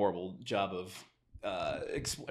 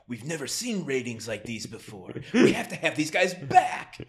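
mock excited producer; 4 semitones higher, 7 dB louder than usual. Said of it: "and they're like".

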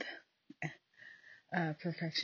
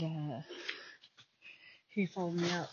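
ordinary talk

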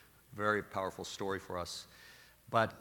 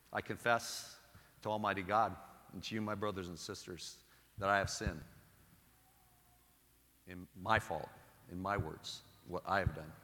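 softer, solemn prayer voice; prayer recitation; 4 dB quieter than usual. Acted. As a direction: recite the confession reverently. Reciting the Confiteor.

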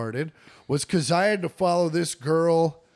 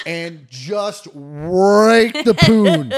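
laughing; 4 semitones higher, 8 dB louder, much slower than usual. Acted. Loud laughing punchline.

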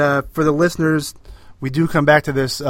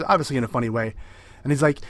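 ordinary talk, nothing marked

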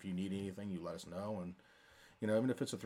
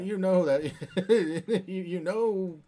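laughing; 9 semitones higher, 10 dB louder, slower than usual. Real, not acted. Laughing about noisy exoskeletons.

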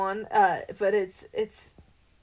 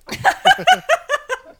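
big laugh; 11 dB louder than usual. Real, not acted. Laughs at own joke.

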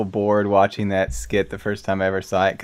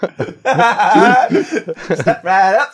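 loud, high barker call; carnival barker; 11 semitones higher, 8 dB louder than usual. Pretend. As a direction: drum up the crowd like a barker. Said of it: "sounds like a carnival barker".

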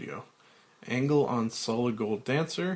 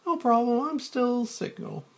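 high, fast woman's voice; Claire; 13 semitones higher, 4 dB louder than usual. Acted. Claire noticing Frank's change, in a high voice.

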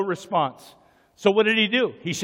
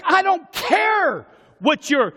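defiant shout; 5 semitones higher, slower than usual. Acted. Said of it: "shushing the shout".

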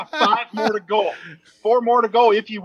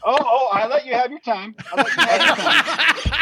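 laughing protest; 6 semitones higher than usual. Real, not acted.

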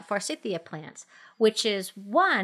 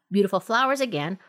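usual speaking voice

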